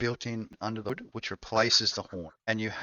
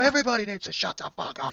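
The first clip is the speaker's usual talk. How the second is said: mock shouting; 12 semitones higher than usual. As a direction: holler it as if joining the shouting.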